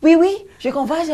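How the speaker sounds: high, loud mocking French accent; mock French speaker. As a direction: show off your French like a know-it-all. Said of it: "And it was like".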